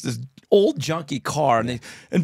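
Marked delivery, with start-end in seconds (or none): none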